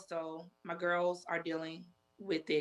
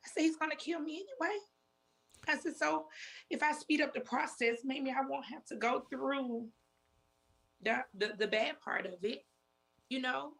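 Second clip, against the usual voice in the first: desperate; 7 semitones higher than usual. Retold or imitated. Retelling suicidal words to sister.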